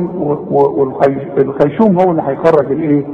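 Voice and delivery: normal talking voice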